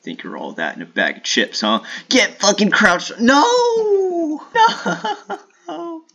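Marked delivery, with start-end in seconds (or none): 2.1-6.0 very high squealing shout with laugh; high excited squeal and laugh; shrieking. Real, not acted. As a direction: squeal in panic at the game. Shrieking at game moment.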